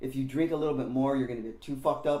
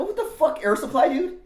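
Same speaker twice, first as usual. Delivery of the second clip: much higher, louder cry of disbelief; outraged disbelief; 9 dB louder than usual. Retold or imitated. Teen shocked [name]'s tape is Air Supply, loud high disbelief. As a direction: call him out, shocked and mocking.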